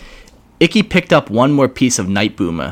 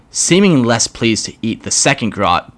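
ordinary delivery, nothing marked

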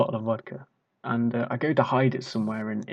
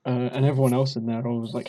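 ordinary talk, nothing marked

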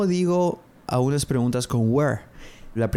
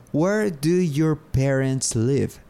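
slow model question; 3 semitones higher, much slower than usual. Shown as a demonstration. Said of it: "model question".

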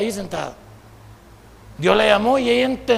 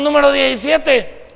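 slow, higher calling-out of a number; number caller; 5 semitones higher, 4 dB louder, slower than usual. Retold or imitated. Clerk calls a customer who missed his turn, number caller. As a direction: imitate a clerk calling the queue. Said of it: "Desde hace rato dijimos".

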